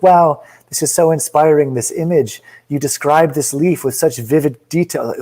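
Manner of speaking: mock the Reddit commenters' gushing praise. Poetry host mimics fawning Reddit commenters, mock gushing. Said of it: "Everyone's always like".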